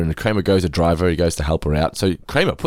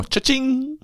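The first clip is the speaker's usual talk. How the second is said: playful cha-ching. Pretend. Joking money sound.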